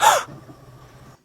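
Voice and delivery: sharp, high, loud gasp; shocked gasp. Acted. Hero threatened with magic wand, high shocked gasp. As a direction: gasp in storybook alarm. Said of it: "she gasped".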